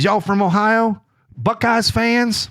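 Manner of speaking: high imitated Southern twang, stretched at the end; thick Southern accent. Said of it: "thick Southern accent".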